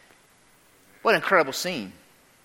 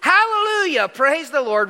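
high, loud cry of worship; soaring exclamations, easing at the end; 9 semitones higher, 4 dB louder than usual. Acted. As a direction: cry it out in ringing praise. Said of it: "They cried".

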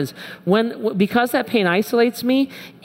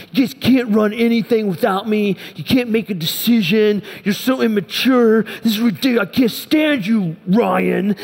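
higher-pitched, exasperated mock tirade; frustrated rant; 3 semitones higher than usual. Boss venting in his head at his staff, frustrated. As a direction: rail at them in exaggerated exasperation. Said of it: "I just was so frustrated with the staff".

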